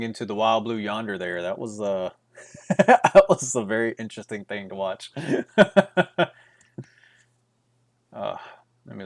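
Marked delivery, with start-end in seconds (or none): 2.4-5.1 chuckling through the words; amused, laughing while speaking. Real, not acted. Laughing about a player's wander. 5.2-6.3 a big amused laugh; loud laugh. Real, not acted. Laughing about a player's wander.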